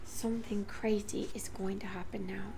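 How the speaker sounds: hushed inner thought; whispered thought. A narrator's reading. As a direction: whisper it to yourself.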